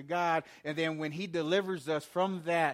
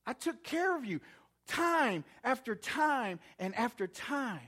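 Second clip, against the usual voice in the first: high, emphatic scolding as God; exasperated rebuke; 6 semitones higher than usual. Acted. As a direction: scold a people who keep relapsing. God rebukes the people, acted.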